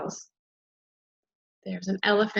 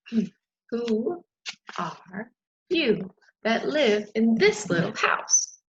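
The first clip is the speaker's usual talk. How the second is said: slow; slower than usual. Acted. Butterfly asks slowly.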